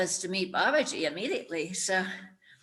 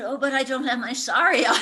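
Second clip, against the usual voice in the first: higher, louder fretful objection; anxious protest; 6 semitones higher, 8 dB louder than usual. Retold or imitated. To her guide in line, a worried protest. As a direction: plead it in a worried rush.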